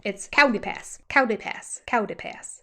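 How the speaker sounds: Coyote said as cow de, first syllable like cow; imitating Cody. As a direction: say cow de pass with cow stressed. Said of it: "sounds more like a cow".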